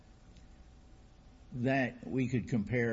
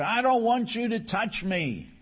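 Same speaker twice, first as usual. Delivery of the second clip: much higher, loud angry shout; furious yell; 10 semitones higher, 5 dB louder than usual. Acted. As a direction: yell him off in pain and rage. Injured man refusing the doctor.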